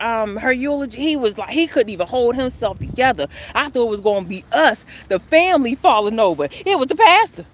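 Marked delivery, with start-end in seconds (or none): none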